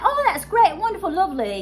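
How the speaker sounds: high-pitched imitation of enthusiastic well-wishers; gushing approval. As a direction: act out delighted approval. Imagined approving reaction to learning French.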